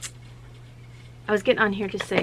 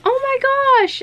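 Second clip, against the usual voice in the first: much higher, louder mock gasp of delight; excited; 9 dB louder, slower than usual. Mother to her daughter about the strawberries, a high and loud excited gasp. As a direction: gush like you just saw treasure. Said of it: "And I was like".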